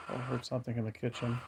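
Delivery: soft and fast, uneasy; hurried half-whisper in the dark; nervous hush. Real, not acted. Hears a noise in the dark.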